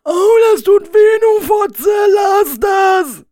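high, loud protesting cry; pained shout. Acted. Rainer under the whip, high loud pained shout. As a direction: yell at her to stop. Whipped, crying out.

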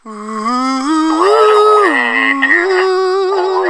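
long, high, drawn-out wail; mock crying wail. Dad recalls crying at a movie song, high mock wail. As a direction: stretch it into a high mock sob. I started to cry.

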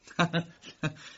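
short, irritated laugh through the nose; spiteful laugh. A narrator's reading. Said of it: "کی با حرص خندید".

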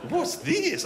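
high-pitched startled question; surprised questioning. Acted. Acting the date seeing the caravan.

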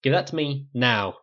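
loud parade-ground snap; snapped command. A narrator's reading. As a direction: snap out the order. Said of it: "his voice had a snap to it".

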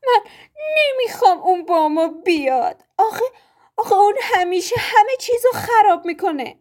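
high, whiny fake crying with yelps; fake sobbing. A narrator's reading. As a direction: sob theatrically to get his way.

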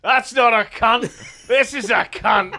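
loud, slow, high Aussie parody, laughing; mock Australian accent. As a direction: ham up an Australian catchphrase with big emphasis. Parodying Australian film line, laughing.

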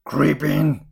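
loud, put-on witch voice; commanding witch. A narrator's reading. Witch ordering Gretel into the oven, loud and commanding. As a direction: bellow the order as the wicked witch.

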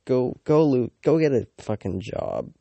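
loud, angry outburst at lazy drivers; furious shout at the taxi drivers; angry rant. Real, not acted. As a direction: yell it like you are fed up with them.

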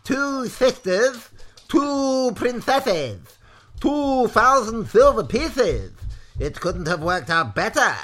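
loud, high, slow crowing; gloating villain. A narrator's reading. Maudmont counting the expected riches, loud gloating delight. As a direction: savour each item, loudly and drawn out.

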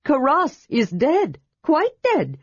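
dead stressed firmly, slow; emphatic kender. A narrator's reading. Tass insisting to Armin that Karas is dead, emphatic. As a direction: lean on dead each time. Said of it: "He laid emphasis on that".

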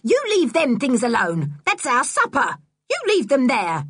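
high, loud indignant yell. A narrator's reading. Woman yelling at boy taking her supper, angry yell. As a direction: shout at him to back off. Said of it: "Missus Stick yelled at him".

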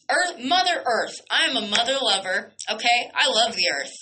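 ordinary talk

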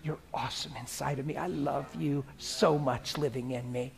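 prayer sinking to a soft hush; private prayer. Shown as a demonstration. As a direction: talk to God like a close friend. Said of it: "I'll be driving and just talk like that".

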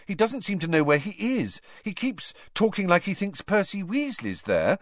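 Harry reporting to Dumbledore; anxious boy. Acted. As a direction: tell it fast and worried.

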